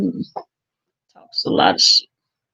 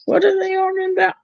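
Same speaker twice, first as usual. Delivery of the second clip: surprised question; 12 semitones higher, much faster than usual. Real, not acted. Asks what they argue about.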